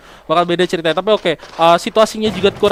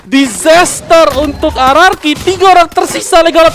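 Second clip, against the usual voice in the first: excited; 8 semitones higher, 5 dB louder than usual. Real, not acted. Esports caster hype call.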